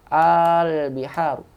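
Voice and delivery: "Al biharu" slowly, opening aa held long; long merged hamzah. Shown as a demonstration. Teacher repeating the demo, "Al biharu" with lengthened a. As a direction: hold the first a of "Al biharu" before the l. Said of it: "menjadi hamzah panjang".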